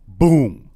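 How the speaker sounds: a sudden loud boom, punched out; imitated sonic boom. Telling friends about the wreck, sudden loud boom sound. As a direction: blast the boom like a crash impact. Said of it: "like the sonic boom".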